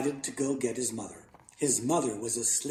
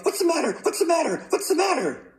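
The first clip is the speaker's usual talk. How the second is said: very high, rapid-fire panicked yell; startled yell; 4 dB louder, faster than usual. A narrator's reading. Father whacked awake with a pillow, startled yelling. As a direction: squawk it high, jolted out of sleep. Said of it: "jumped out of bed and yelled".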